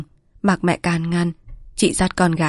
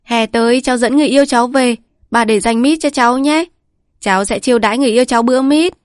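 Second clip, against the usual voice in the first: put-on higher, brisk and louder, a chattering child; excited child voice; 6 semitones higher, 6 dB louder, faster than usual. A narrator's reading. Granddaughter telling grandma her plans, excited child voice. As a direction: bubbly kid racing through her plans.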